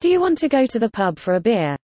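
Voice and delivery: normal talking voice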